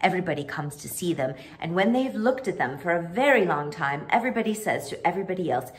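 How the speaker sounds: ordinary delivery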